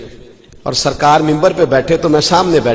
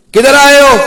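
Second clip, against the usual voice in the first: high, loud angry demand; angry uncle; 10 semitones higher, 5 dB louder than usual. Retold or imitated. Uncle angrily confronts nephew who wants to leave, loud and high. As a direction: bark it at the nephew from above.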